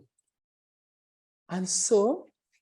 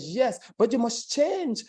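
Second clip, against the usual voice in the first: ordinary delivery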